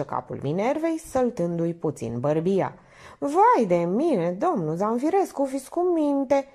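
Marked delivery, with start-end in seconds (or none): 3.2-6.5 giggling. A narrator's reading.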